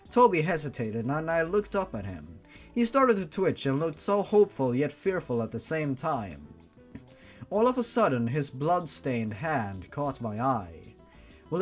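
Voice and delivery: usual speaking voice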